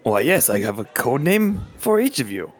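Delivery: Levi voice. Acted. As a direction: announce it like a proud host. Levi answers in character.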